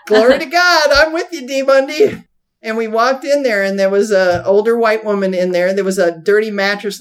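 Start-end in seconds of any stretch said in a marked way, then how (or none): 0.1-2.2 raised, louder mock-eager "Glory to God"; eager exclamation. Narrator to Dee Mundy, agreeing to go witnessing, mock eager. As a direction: play along brightly, a little louder. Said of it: "I was like".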